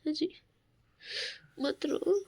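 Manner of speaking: soft, tender pleading; soothing. Real, not acted. Wife softly asking her husband to smile, tender. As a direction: gently beg him to smile.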